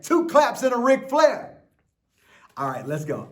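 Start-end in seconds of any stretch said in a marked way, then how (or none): 0.0-1.6 higher and louder, pushing for more energy; a cheerleader's call; bigger hype call. Shown as a demonstration. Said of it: "you gotta do it better than that".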